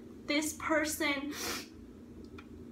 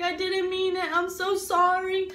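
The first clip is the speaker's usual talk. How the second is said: retold pleading apology; 4 semitones higher, 6 dB louder than usual.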